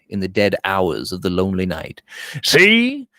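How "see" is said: sudden high, loud exclamation; sudden call. A narrator's reading. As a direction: blurt it out, catching her attention. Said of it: "said George suddenly".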